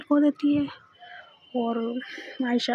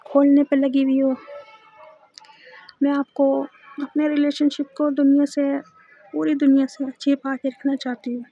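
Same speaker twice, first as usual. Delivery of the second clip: ordinary delivery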